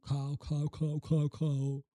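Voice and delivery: cow shouted over and over in practice; loud drilling. Shown as a demonstration. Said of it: "It's not cow".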